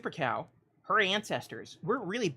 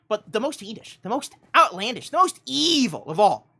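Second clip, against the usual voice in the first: rising louder and higher to evil; dramatic build; 4 semitones higher, 8 dB louder than usual. Acted. Host teasing the story's villain, building dramatic crescendo. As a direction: build it step by step to a villainous peak.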